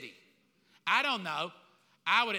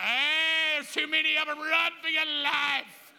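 higher, louder call to run, slowing at the end; panicked warning; 7 semitones higher, 7 dB louder than usual. Retold or imitated. Preacher imagines yelling to the guests to flee, high and loud. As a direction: yell it like you're fleeing. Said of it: "I'm like".

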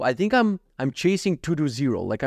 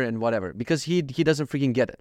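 ordinary delivery

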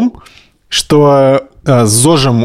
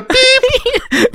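high slow beep sound over the word; bleeped out. Pretend. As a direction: mimic a censor bleep. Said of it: "Мы просто запикаем его речь".

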